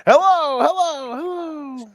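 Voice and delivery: high calling voice with echo; echoing empty hall. Acted. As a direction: call out into an empty building. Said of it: "sound like you're in an empty building".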